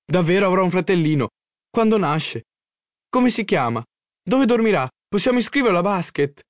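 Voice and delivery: high, eager string of questions; breathless with joy. A narrator's reading. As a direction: fire off questions in joyful excitement. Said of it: "non riuscivo a respirare dalla gioia".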